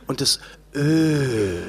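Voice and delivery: low, slow, soft Ö; drawn-out soft Ö. Shown as a demonstration. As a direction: stretch the Ö out softly. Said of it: "das Sprechen".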